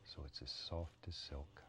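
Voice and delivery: hushed, soothing painting lesson; soft-spoken painting instructor. Acted. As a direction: murmur the steps softly to the viewer.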